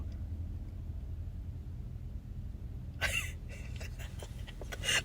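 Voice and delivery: very high breathless wheeze of a laugh; stunned laugh. Real, not acted. Laughing at viewers over his death, breathless wheeze. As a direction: wheeze through the shock.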